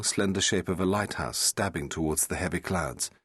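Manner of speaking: ordinary delivery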